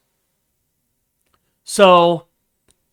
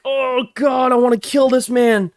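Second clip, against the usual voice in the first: higher, strained inner fury; bottled-up rage, pitched up; pent-up rage; 7 semitones higher than usual. Retold or imitated. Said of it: "even if I'm steaming, raging".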